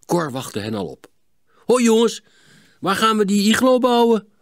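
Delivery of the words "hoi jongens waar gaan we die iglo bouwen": put-on bright, eager boy calling out much higher; chirpy greeting to his friends; cheerful. A narrator's reading. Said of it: "vroeg hij opgewekt". (opgewekt en vrolijk)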